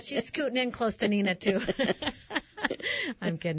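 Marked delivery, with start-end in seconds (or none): 1.7-3.2 bursts into a high laugh. Real, not acted. Joke about friend Karen.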